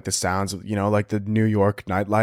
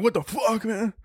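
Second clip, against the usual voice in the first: retold startled reaction; 9 semitones higher than usual. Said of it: "I'm like".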